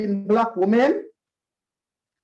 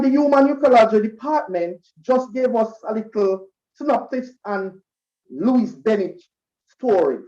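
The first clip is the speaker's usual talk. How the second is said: usual speaking voice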